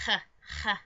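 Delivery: short throaty "h" rasped at the back; guttural throat sound. Shown as a demonstration. Vlogger explaining the pigeon sound, guttural demo. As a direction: demonstrate a German-style guttural "ch", twice. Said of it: "the same in German, like".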